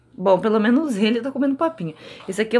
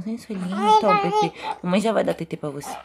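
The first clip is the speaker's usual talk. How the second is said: ordinary talk, nothing marked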